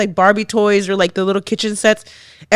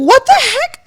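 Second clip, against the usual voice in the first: shocked; 5 dB louder than usual. Retold or imitated. Retelling her shock at the toy.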